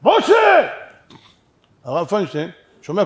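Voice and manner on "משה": loud high shout across a street. Acted. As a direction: call out the name at full volume. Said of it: "מישהו שם צועק".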